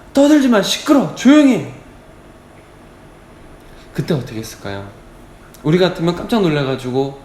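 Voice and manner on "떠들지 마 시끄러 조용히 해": short, high-pitched scolding bursts; rebuking. Acted. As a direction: tell someone off as an annoyed crowd. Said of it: "많은 사람들이 꾸짖어 이르되". (버럭 꾸짖듯이)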